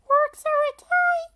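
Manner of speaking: put-on high girlish voice, loud and bright; Lucy's voice. A narrator's reading. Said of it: "Lucy says".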